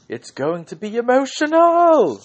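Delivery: high, loud screamed catchphrase; Vinnie Jones scream. Retold or imitated. Host quoting Vinnie Jones, screaming it high and loud. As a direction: belt it out like a hyped guest host. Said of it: "And he just screamed".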